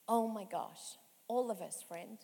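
usual speaking voice

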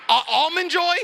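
high-pitched, incredulous outrage; mock offended. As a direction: repeat it as a scandalized question. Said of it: "I was so offended".

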